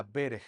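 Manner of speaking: "berej" ending in a throaty j; Hebrew word pronunciation. Shown as a demonstration. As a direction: say "berej" slowly, final Spanish j. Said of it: "en hebreo, tú dirías". («berej» con jota final)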